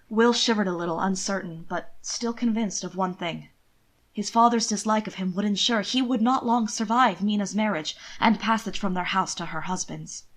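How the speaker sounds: plain reading voice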